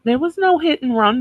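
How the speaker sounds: sarcastic echo. Retold or imitated. Echoing the driver's denial.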